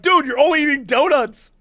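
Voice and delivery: high-pitched mock disbelief at a friend; retold exasperation. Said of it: "And we're like".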